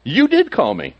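high, insistent "DID" in "you did call me"; stress on DID. Acted. Boy insisting to Eli after three times, stress on DID. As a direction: punch "did" high, as if contradicting. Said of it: "I put the emphasis on that".

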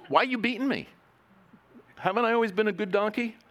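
high pleading donkey voice; talking donkey. Acted. Preacher voicing the donkey to Balaam, high and pleading. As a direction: whine it out like a hurt donkey.

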